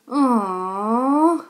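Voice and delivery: long, loud groaned "aw"; class groan. A narrator's reading. Class groaning at teacher's homework news, a drawn-out groan. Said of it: "The class groaned".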